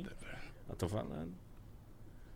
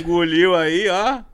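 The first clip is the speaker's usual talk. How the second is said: excited laughing; 7 semitones higher, 11 dB louder, slower than usual. Real, not acted.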